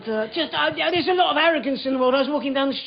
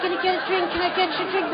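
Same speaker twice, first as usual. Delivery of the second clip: lightly voiced, higher-pitched offer; small-talk stranger offering a drink; chatty stranger; 5 semitones higher, faster than usual.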